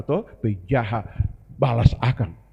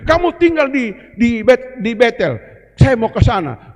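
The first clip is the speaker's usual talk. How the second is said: Elijah's firm instruction; 7 semitones higher, 6 dB louder than usual. Acted. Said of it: "dia bilang".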